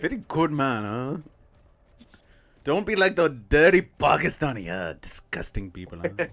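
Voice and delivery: put-on Indian accent; mock Indian accent with a sneer. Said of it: "do your relatives talk like that?".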